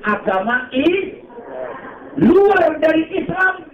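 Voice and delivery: high, slow, drawn-out preaching; preaching fervour. Acted. Preaching at full force. (ceramah sumanget)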